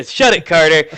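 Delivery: "Shut it, Carter" loud in clean English; perfect English. Acted. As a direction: snap "Shut it, Carter" without the foreign accent. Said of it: "In perfect English, as he says".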